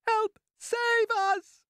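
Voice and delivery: frantic high-pitched cries for help; crying out. A narrator's reading. Ladybird begs for help as the Cloud-Man descends, crying out frantically. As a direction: cry out loudly, very high, pleading to be saved. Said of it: "cried the ladybird".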